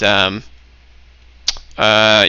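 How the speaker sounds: usual speaking voice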